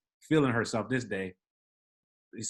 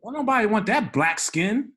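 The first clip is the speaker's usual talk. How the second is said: mock sneering woman, higher and louder; quoting her insult; 9 semitones higher, 6 dB louder than usual. Drunk woman insulting the concierge in the elevator, mocked higher and louder. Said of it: "She was like".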